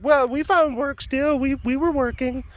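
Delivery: Heidi's retort. Retold or imitated. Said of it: "She's like".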